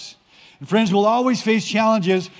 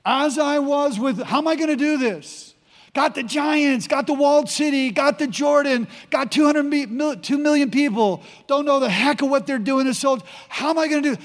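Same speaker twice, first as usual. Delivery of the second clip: higher-pitched, mock-worried list of troubles; overwhelmed fretting; 5 semitones higher than usual. Preacher as Joshua facing giants and the Jordan, overwhelmed fretting. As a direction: fret aloud, piling up the problems in a raised voice.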